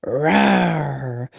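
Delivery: put-on loud childish monster roar; kid's pretend dinosaur roar; playful dinosaur roar. A narrator's reading. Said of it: "does his best dinosaur roar".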